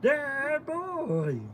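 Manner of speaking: very high, drawn-out mock cooing; imitated follower voice. Streamer showing her dog how followers sound, high mock coo. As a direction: squeal softly like a besotted fan. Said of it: "They're all saying it in that voice".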